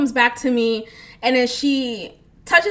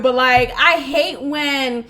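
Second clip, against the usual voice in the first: ordinary talk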